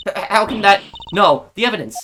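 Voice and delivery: acted alarm at a sudden loss; shocked stammer. Prosecutor finds the evidence missing.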